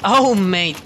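startled. Real, not acted. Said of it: "I got scared".

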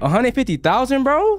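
can't believe the price; disbelief. Real, not acted. To the robber asking 150K, high disbelief.

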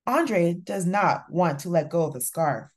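usual speaking voice

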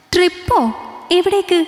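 high, startled double question; a wife shocked by a trip request. A narrator's reading. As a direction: jump up in alarm at the news. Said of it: "ഞെട്ടലോടെ അവൾ ചോദിച്ചു".

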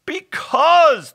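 very high, loud howl; hammed-up anguished howl; howling hobgoblin. A narrator's reading. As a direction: howl the word out in a creature voice. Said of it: "the hobgoblin howled".

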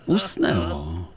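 ordinary delivery, nothing marked

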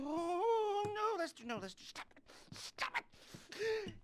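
high, crying mock self-reproach; mock whimpering. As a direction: whine as if scolding yourself. Mock self-scolding, new meds joke.

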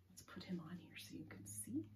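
muttering to herself while sticking it up; whispered aside. Real, not acted. Teacher placing the leprechaun on the board, whispered. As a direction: say it under your breath. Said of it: "aside while setting up board".

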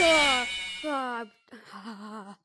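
loud startled screams; screaming in fright. Real, not acted. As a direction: scream at the jump scare. Jump scare screams.

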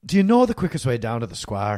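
Irish accent at an easy low pitch. Shown as a demonstration. Host demonstrating his own Irish accent, pitched low. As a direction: ask it as a low-voiced Irishman. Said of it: "I do my Irish a little lower".

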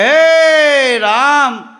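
long, drawn-out, loud and high "હે રામ"; exhausted cry of despair; weary groan. Acted. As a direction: moan it like life is too much. Weary man sighs to friend.

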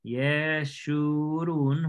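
Yeshurún drawn out syllable by syllable, with sh; pronunciación hebrea. Shown as a demonstration. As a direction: say Yeshurún very slowly, stretching each syllable. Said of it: "aquí está la palabra Jesurún". (Yeshurún muy lento, sílaba por sílaba, con sh)

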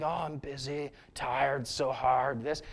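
lightly voiced gloomy grumbler; mock weary complainer. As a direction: imitate a moaning, worn-out friend. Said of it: "they just say negative things".

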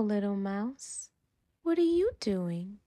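usual speaking voice

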